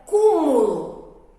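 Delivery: "cúmulo" with a loud, long CÚ; stress on first syllable. Shown as a demonstration. Teacher giving the last little word, stressed first syllable. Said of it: "então é a sílaba cu".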